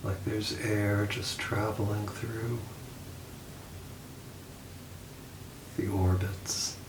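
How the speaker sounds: soft, unhurried meditation voice with long pauses; calm, hushed guide easing listeners toward sleep; guided meditation. Acted. Leads a guided body scan.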